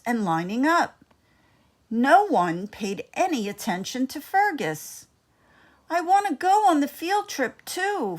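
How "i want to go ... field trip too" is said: higher voice, a touch of longing; a small mouse wishing to be included; pleading mouse. A narrator's reading. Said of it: "Fergus thought".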